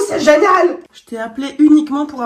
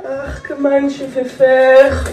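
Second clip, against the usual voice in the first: hammed-up German accent, slow at the end; French with a joking German accent; 4 semitones higher, 4 dB louder, slower than usual. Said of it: "Faut vraiment que j'arrête avec l'accent allemand".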